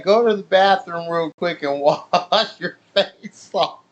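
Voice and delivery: words broken by laughter; laughing teacher. Retold or imitated. Teacher sends the gravel-faced boy to wash, laughing through words. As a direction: try to give an order while cracking up. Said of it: "she's laughing".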